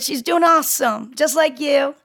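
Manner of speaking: ordinary talk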